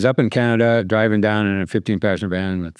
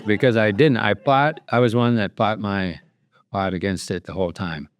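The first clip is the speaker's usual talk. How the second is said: ordinary talk, nothing marked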